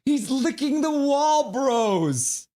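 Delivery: slow, high, loud shout; slow loud incredulous yell; loud exclamation. Real, not acted. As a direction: announce it loudly in disbelief. Incredulous about dog.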